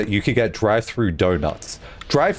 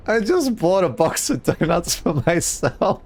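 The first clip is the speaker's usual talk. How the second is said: ordinary talk, nothing marked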